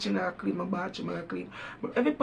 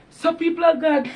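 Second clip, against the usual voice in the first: calling out; 8 semitones higher, 8 dB louder than usual. Real, not acted. Addressing viewers like congregation.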